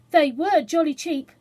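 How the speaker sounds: put-on loud, eager boy's voice; a boy boasting, loudly; excited child. Children quarrel over fireworks.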